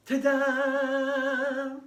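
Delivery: high, drawn-out ta-da; magic-trick flourish. A narrator's reading. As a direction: present it like a magician's reveal. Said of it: "some sort of magic trick".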